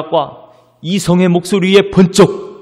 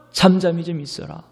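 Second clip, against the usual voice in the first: usual speaking voice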